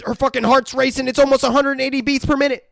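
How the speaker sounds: high, urgent report; mock alarmed doctor. Doctor calling out her racing pulse, mock alarm. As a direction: imitate a doctor raising the alarm.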